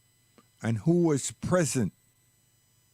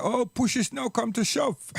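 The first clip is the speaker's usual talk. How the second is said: higher-pitched mock exclamation; teasing mother; 8 semitones higher than usual. Mother answering her child's complaint with a proverb, teasing.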